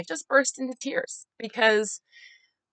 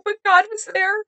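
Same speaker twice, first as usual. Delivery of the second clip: real crying voice, pitched very high; tearful; 11 semitones higher than usual. Real, not acted. Woman telling of God's comfort after being hurt, tearful. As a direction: struggle through tears to get it out.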